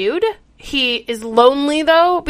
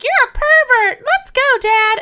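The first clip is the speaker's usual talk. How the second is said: high, louder disgusted daughter; mock outraged daughter; 11 semitones higher, 6 dB louder than usual. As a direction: shriek it in disgust. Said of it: "the daughter and the dad are like".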